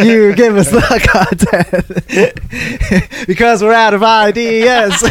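slow, laughing plea. Real, not acted. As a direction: beg through laughter.

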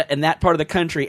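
ordinary talk, nothing marked

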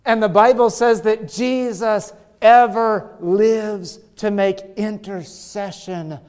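slowed preaching; slow solemn emphasis. Real, not acted. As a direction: lean on each word.

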